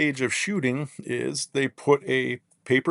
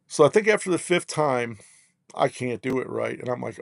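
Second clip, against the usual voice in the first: normal talking voice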